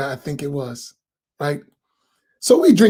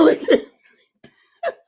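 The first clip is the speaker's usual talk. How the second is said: mock horrified refusal; 8 semitones higher, faster than usual. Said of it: "He said".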